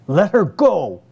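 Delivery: high, loud command; commanding shout. A narrator's reading. Husband ordering his wife to release their daughter, shout. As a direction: bark it as a last warning. Todd about to strike.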